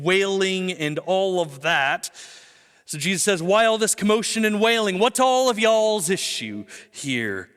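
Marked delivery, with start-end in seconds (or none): 3.4-7.5 mildly exasperated, raised-pitch questioning; exasperated challenge. Acted. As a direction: challenge the mourners as Jesus, slowing on the last question. Jesus confronts the wailing mourners.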